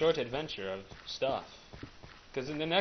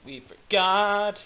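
slow, high, loud silly voice; hammed-up high voice; 10 semitones higher, 4 dB louder, slower than usual.

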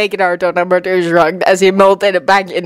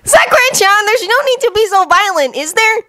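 put-on high-pitched boy pleading; high, chiding kid voice; high boyish character. A narrator's reading. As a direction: pitch it way up, a youngster protesting. Naruto character pleading in dialogue.

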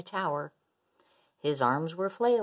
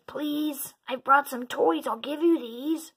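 high-pitched, insistent plea; pleading; 9 semitones higher, 5 dB louder than usual. A narrator's reading. Minosaur offering toys to ignoring dinos, pleading. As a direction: beg in a raised voice.

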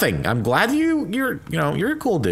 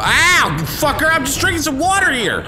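high, loud pained shouting; outraged yell; 5 dB louder than usual. Real, not acted. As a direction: yell in shocked protest. Hit while drinking water.

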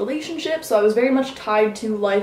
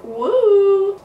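excited cheer; 10 semitones higher, 5 dB louder than usual. Real, not acted. Cheering about doing a mukbang.

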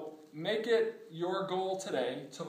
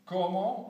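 "Como" louder, stress on the first O; 5 dB louder than usual. Shown as a demonstration. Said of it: "on the first O".

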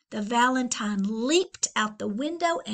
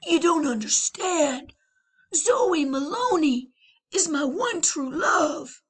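loud, higher protest; pleading boy; 6 semitones higher, 6 dB louder than usual. Acted. Leon to the boys, loud pleading. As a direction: insist on true love.

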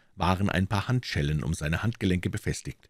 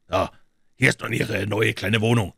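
higher, pleased announcement; satisfied; 4 semitones higher, 4 dB louder than usual. A narrator's reading. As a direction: present it with a touch of satisfaction. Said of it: "sagte Leon zufrieden".

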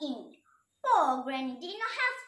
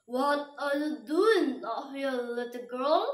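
fake friendly wolf. A narrator's reading. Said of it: "in the voice as friendly as he could muster".

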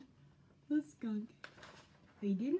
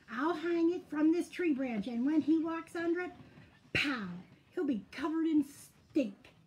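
higher, louder, building plot; scheming excitement; 6 semitones higher, 5 dB louder than usual. A narrator's reading. Skunk plots against Bear, higher and loud. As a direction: punch out the plan gleefully.

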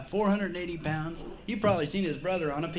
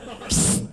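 psst. Retold or imitated. Robber calls from bushes.

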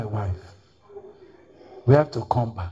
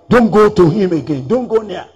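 loud urgent warning, pitched up; warning shout; 5 semitones higher, 9 dB louder than usual. Retold or imitated. Church members warning the convert away, loud urgent warning. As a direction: warn him off with raised voice. Others warning against the preacher.

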